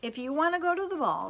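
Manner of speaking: loud, high and fast; brisk, booming fairy godmother. Acted. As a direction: sweep in with grand assurance. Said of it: "said her fairy godmother".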